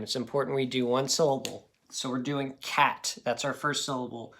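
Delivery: normal talking voice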